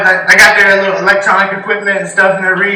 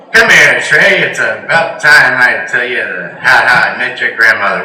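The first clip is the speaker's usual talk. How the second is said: lightly voiced future grandfather; 8 semitones lower than usual. Comic telling his young buddy his future story, lightly low old voice. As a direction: play yourself as an old grandpa. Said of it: "your story's gonna go a little bit like this".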